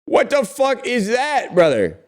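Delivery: high, loud, drawn-out shock; shocked disbelief. Real, not acted. Looking at the wasp photo with chat, high loud shock.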